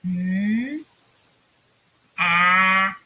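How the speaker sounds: held mm then loud ah; teacher modelling sounds; separate sounds. Shown as a demonstration. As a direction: sound each letter apart. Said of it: "We're gonna say each sound".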